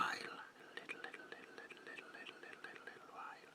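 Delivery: "little" repeated in a rapid, vanishing whisper; creepy whispered stutter sinking almost to silence; obsessive fading echo. Acted.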